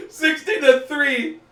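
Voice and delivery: high, cracking up at the end; laughing. Real, not acted. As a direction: squeal the score, then dissolve into laughter. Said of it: "I can't stop laughing".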